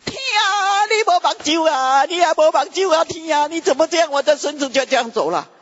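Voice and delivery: high wailing lament, imitating a grieving relative; imitating a wailing mourner. Retelling how a funeral mourner cries, imitating a high wail. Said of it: "啊，哭的人怎么哭？".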